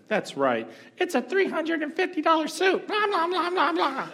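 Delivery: very high, nasal-free mocking squeak of a wife. Wife chiming in against the waitress, in a high mocking voice. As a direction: parody her shrill pile-on. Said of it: "his wife chimes in".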